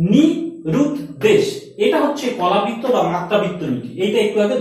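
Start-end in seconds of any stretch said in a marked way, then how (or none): 0.1-1.4 নি, then রুদ দেশ in separate beats; syllable by syllable. Shown as a demonstration. Teacher showing the কলাবৃত্ত count, paused. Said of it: "এইবার তাকাও".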